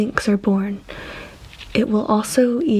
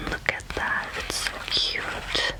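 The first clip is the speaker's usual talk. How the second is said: tender hushed coo over a cute animal; cooing whisper; 8 dB quieter than usual. Real, not acted. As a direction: coo it softly, adoringly.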